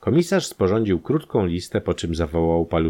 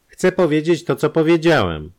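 a brusque, much higher and louder snap; curt retort; 10 semitones higher, 6 dB louder than usual. A narrator's reading. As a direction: cut him off impatiently. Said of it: "odparł pryskliwie lekarz".

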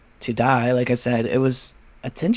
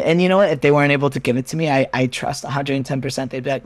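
ordinary talk

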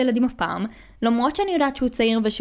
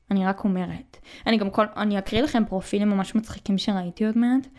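usual speaking voice

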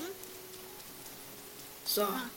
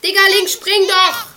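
shouting; 7 semitones higher, 14 dB louder than usual. Real, not acted. Urging companion to jump.

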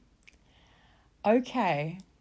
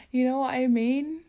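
usual speaking voice

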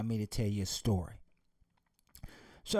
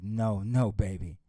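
low, calm and steady; father calmly reassuring his daughter in the storm; 6 semitones lower than usual. Acted. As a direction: reassure the frightened child. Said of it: "The father calmly looked back".

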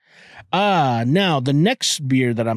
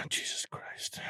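exasperated whisper; 19 dB quieter, slower than usual. Real, not acted. Exasperated at the video clip.

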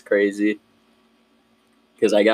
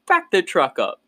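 high-pitched mock command; bossy partner; 8 semitones higher than usual. Partner orders ranger to reverse, high mock-bossy voice. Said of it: "So she goes".

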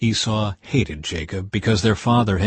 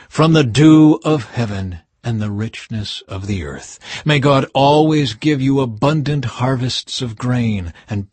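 solemn blessing; 2 semitones higher, 4 dB louder than usual. Acted. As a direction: pronounce it like a patriarch's blessing. Isaac blessing his son.

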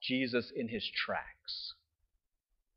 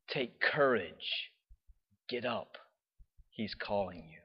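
lower, soft and very slow; gentle encouragement; 6 semitones lower, 8 dB quieter, slower than usual. Retold or imitated. Crowd gently encouraging the blind man, soft. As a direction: coax him up tenderly.

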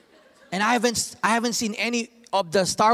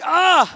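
angry exclamation; 9 semitones higher, 7 dB louder than usual. Retold or imitated. Father exclaims.

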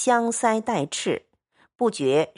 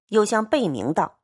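ordinary delivery

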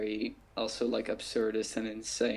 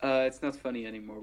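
usual speaking voice

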